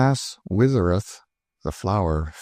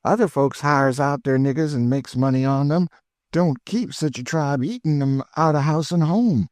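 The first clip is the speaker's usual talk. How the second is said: put-on high, eager old cook's voice; high cheerful Chloe, teasing her missus; Aunt Chloe; 6 semitones higher than usual. A narrator's reading.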